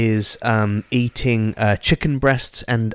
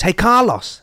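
lightly acted, higher-pitched call; hailing cousin; 7 semitones higher, slower than usual. Voicing cousin hailing Carlos.

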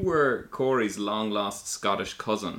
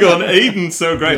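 high, loud fan gushing; mock gushing; 7 semitones higher, 9 dB louder than usual. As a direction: mimic friends gushing over a favourite. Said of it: "You guys are all like".

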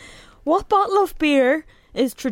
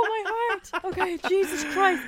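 high, soft, shaken relief with laughter; catching breath after a fright; nervous laughing aftershock; heart in throat; 5 semitones higher, 5 dB quieter than usual. Real, not acted. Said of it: "My heart right now is in my throat".

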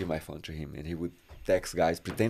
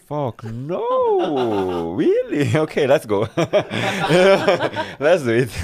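high, louder, laughing as the no turns into a yes; laughing mock refusal; 7 semitones higher, 7 dB louder, slower than usual. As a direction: retell the refusal while cracking up. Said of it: "And I was like".

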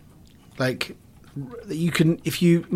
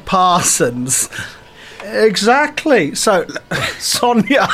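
ordinary talk

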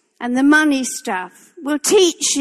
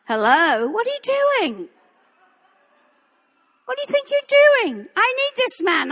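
high, exasperated demand at God; a high protest flung upward; imitating herself arguing with God, voice up high; pleading with God; 9 semitones higher than usual. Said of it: "I've said to God".

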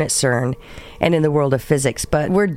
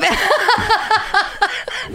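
high laugh. Real, not acted. Burst of laughter.

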